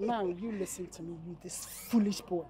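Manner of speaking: low menacing warning. Acted. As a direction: warn him quietly that she means it. Woman threatens the pestering man.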